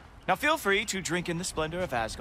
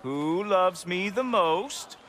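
higher, louder, slow sing-song coo; baby talk; 4 semitones higher, 3 dB louder, slower than usual. Acted. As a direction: dote on the horse like a pet. Baby talk to his horse.

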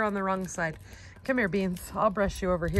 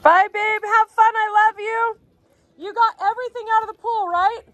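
cooing pet voice; 10 semitones higher, 5 dB louder than usual. Real, not acted. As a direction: coo at them like babies, pitched way up. Cooing goodbye to the goats.

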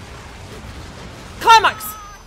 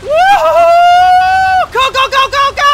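a very high, loud whoop, then chanting; excited whoop; 11 dB louder, much slower than usual. Real, not acted. Player cheering his own combo on, ecstatic whooping. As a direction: cheer yourself on at full volume.

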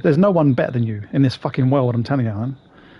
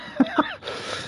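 high squeaky giggle; 9 dB quieter than usual. Real, not acted. After joking "kiss me.

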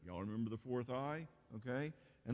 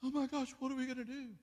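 high, loud, hammed-up panic; mock panicked husband; 13 semitones higher, 3 dB louder, faster than usual. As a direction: squeal it like an anxious husband losing it. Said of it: "freaking out in anxiety".

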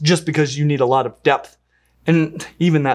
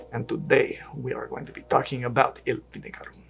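put-on Spanish accent; 2 semitones lower, 5 dB quieter than usual. Host asked for more Spanish accent in the intro, mock Spanish accent. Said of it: "more Spanish accent, please".

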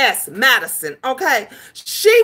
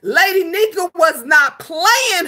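ordinary talk

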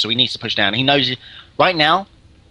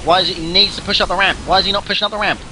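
urgent; 6 semitones higher, faster than usual. Real, not acted. Urging player during tense push.

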